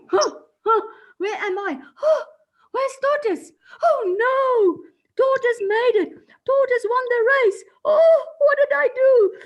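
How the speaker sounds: high, loud panicked cries. Acted. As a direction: wake up in panic. Hare wakes, panicked.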